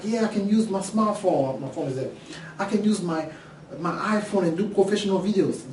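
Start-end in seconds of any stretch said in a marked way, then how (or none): 0.0-1.6 higher-pitched mock eager quote; imitating naive customers. Teacher quotes people sure a smartphone will do, imitating them. Voicing what most people say. 2.6-5.6 higher-pitched mock eager quote; imitating naive customers. Teacher quotes people boasting about iPhone videos, imitating them. Voicing what most people say.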